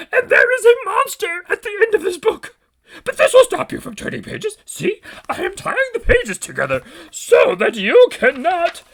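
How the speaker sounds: high, fussy furry monster voice; Grover voice. Acted. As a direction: play Grover anxiously warning the reader. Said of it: "my Grover went from Yoda to Doofenshmirtz".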